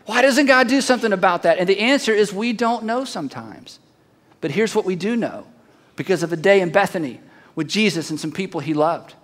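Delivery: ordinary talk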